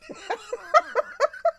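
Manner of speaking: squealing high-pitched laughter. Real, not acted. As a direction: crack up at a friend's comment. Laughing at viewer's comment.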